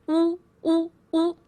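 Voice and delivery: oo said like an owl's hoot, lips rounded and pushed forward. Shown as a demonstration. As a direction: hoot the Chinese oo like an owl. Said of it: "the sound an owl makes".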